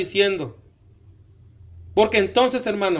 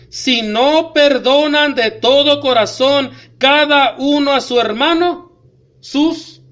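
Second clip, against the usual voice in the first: loud, slow, hammering sermon warning; preaching at full force; 2 semitones higher, 4 dB louder, slower than usual. Acted. Pastor warning the congregation about unforgiveness, loud slow preaching. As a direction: drive the warning home to the church.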